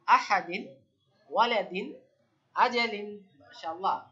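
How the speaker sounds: ordinary talk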